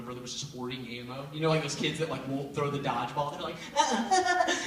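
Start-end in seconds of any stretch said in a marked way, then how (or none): 3.7-4.6 high taunting "ah ah ah" through a laugh; teasing kid. Retold or imitated. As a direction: tease like the kid who won't throw. Kid hoarding dodgeballs, teasing.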